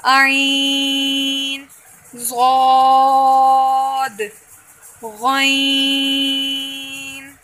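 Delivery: शीन and आइन held for three alifs, slow; drawn-out Tajweed letter drill; elongated letters. Shown as a demonstration. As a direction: stretch each letter name long. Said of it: "तीन अलिफ के बराबर लंबा".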